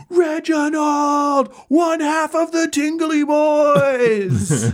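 high, drawn-out crowd cheer; astonished crowd. Acted. Crowd hailing Reginald in the ring, astonished cheer. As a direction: hail your hero in slow, high astonishment. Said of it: "The crowd goes nuts. They are astonished".

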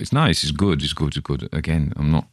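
ordinary delivery

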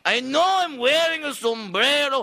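hammed-up Mexican accent, high and loud; mock Mexican accent. Comic mocking the heckler in a thick Mexican accent. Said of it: "Tone down the Mexicana accent".

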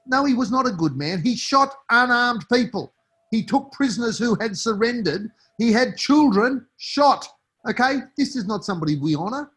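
furious, spell out every crime; angry denunciation. Real, not acted. Rejecting calls to honour Breaker.

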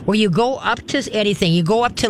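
normal talking voice

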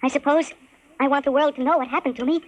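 normal talking voice

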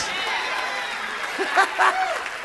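airy overjoyed laugh; breathy high laugh. Real, not acted. Pastor laughing in joy mid-sermon, airy and high. Overcome laugh after shouting.

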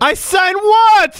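high, slow outraged shout. Retold or imitated. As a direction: stretch the words out and shout them in disbelief. Retold outrage at dentist.

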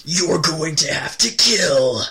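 high, slow drill sergeant voice; drawn-out sergeant threat to recruits. Acted. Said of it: "do the voice of a drill sergeant".

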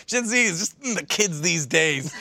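slow, drawn-out mock old-timer; mocking old-timer Ben. Co-host teases Ben for sounding so old, mock old-timer. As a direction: drag it out like a weary old-timer. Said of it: "Ben, the way you said that".

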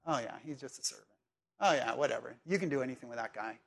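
low, offhand brush-off, quick; dismissive. Acted. Preacher acts out someone writing off a servant, low and dismissive. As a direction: toss it away as if he hardly matters.

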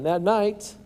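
normal talking voice

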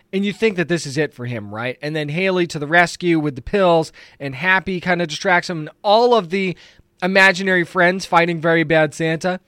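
ordinary talk, nothing marked

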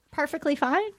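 ordinary talk

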